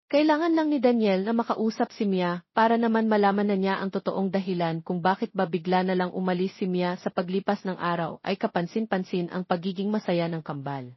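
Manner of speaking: normal talking voice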